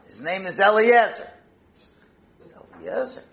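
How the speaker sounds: loud, high-pitched answer; acted forceful reply; insistent father. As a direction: announce the son's name emphatically.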